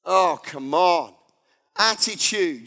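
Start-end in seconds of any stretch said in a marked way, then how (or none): none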